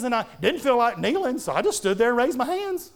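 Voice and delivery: high-pitched imitation of a young son; six-year-old boy. Son telling his daddy what he did, high child impersonation. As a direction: pitch it up like a little boy explaining. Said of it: "Here's a six-year-old boy saying".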